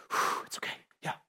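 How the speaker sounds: breathy whispered whew; whispered relief. Real, not acted. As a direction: let out a soft relieved whisper.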